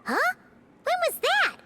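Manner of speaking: high-pitched, startled squeak; taken aback, voice jumping up; startled questioning. Acted. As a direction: blurt it out in high surprise. Startled by unexpected news in scene.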